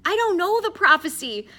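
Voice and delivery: high, loud exasperated protest; frustrated. A narrator's reading. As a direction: complain at the top of your voice. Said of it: "said Addison in frustration".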